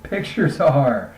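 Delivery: usual speaking voice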